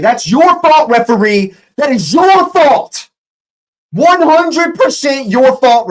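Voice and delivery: high, loud, slow furious yell; furious shout. Real, not acted. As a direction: blast the referee for his mistake. Yelling blame at the referee.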